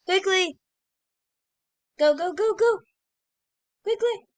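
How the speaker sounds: high, loud, rushed commands; urgent shouting. Real, not acted. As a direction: hurry them along, yelling. Urging teammate.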